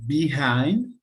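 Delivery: "Behind." said clearly as bi-HIND with a rising check; model pronunciation. Shown as a demonstration. Teacher correcting the student's word, clear model. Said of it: "No sé si se dice así".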